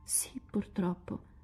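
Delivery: whispered, slow and hushed; barely dares to say it; ashamed whisper. A narrator's reading. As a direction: sussurro vergognoso. Ashamed girl admits the truth.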